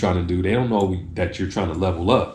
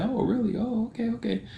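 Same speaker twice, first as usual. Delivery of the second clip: much higher, softer pleasant surprise; mildly surprised reply; 5 dB quieter than usual. Acted. Person told they resemble a classmate, mildly surprised. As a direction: take it in with a soft, high "oh". Acts out a receptive listener's reply.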